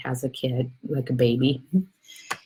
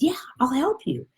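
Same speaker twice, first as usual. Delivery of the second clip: high voice, thinning toward breath; helpful little soul; 12 semitones higher than usual. Acted. Other soul confirms the offer, high and eager. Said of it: "He says".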